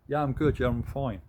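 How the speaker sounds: acted quick "all good" answer; breezy reassurance. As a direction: brush off the question brightly. Acted automatic reply to how are you.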